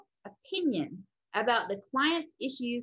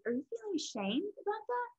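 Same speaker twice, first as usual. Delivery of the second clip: soft, high, tentative question; soft counselor's inquiry; gentle checking-in; 9 semitones higher, 6 dB quieter than usual. Acted. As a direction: gently check with a client, voice lifted and softened. Counselor gently checking a client's feeling.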